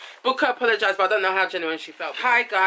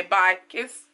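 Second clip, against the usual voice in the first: ordinary delivery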